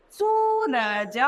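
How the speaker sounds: सूरज said slowly, the ऊ in सू held long; long drawn-out oo. Shown as a demonstration. Teacher modelling the long ऊ matra for pupils, slow. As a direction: draw out the सू in सूरज. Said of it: "उसका उच्चारण हम थोड़ा सा लंबा खींच रहे हैं".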